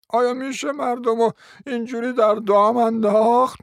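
high, strained howling wail of an outraged lady; unnatural howl. A narrator's reading. Offended hostess howling at the guests. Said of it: "با صدای غیر طبیعی زوزه کشید".

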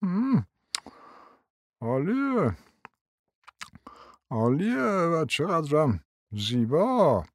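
put-on old prince ogling the daughter, muttering; under his breath. A narrator's reading. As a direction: murmur it to yourself. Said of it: "زیر لب گفت".

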